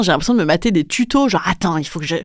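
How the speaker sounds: ordinary talk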